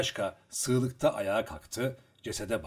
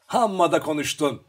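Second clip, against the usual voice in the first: loud, higher-pitched retort; shouting back; 5 semitones higher, 8 dB louder than usual. A narrator's reading. Luka answering the other Cossack while undressing, shouting back. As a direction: throw it back loudly. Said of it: "diye bağırdı ona".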